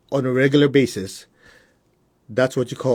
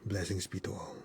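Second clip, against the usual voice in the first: soft, closing blessing, unhurried; quiet prayer; 5 semitones lower, 18 dB quieter than usual. Acted. Teacher closing the group meditation with a soft blessing. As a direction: close the prayer softly.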